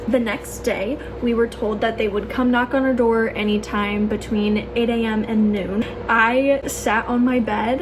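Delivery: normal talking voice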